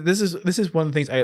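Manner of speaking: ordinary talk, nothing marked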